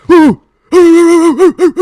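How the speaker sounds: loud, high dog howl-bark; imitated dog bark. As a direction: mimic a barking dog at full volume. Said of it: "the dog comes up".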